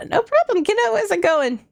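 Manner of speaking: put-on high, sweet old lady voice; grandma voice. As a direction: play a kindly grandma. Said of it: "I was doing grandma voice".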